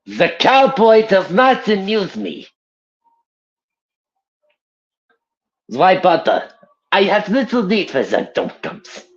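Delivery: a sneering mad German doctor; Richtofen. Acted. Voicing Richtofen's thunder gun line, sneering German accent. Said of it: "that's a line for, um, Richtofen".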